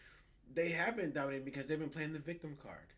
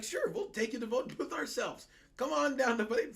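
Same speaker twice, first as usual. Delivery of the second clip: high, loud, hammed-up welcome; mock eager invitation; 8 semitones higher, 6 dB louder than usual. Playing officials ferrying people to vote, mock eager. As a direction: imitate an over-keen official calling voters in.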